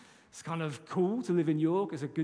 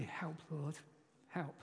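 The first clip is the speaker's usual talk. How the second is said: murmured plea, much softer; quiet prayer; 11 dB quieter, slower than usual. Shown as a demonstration. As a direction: say it under your breath as a prayer. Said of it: "I want you to pray".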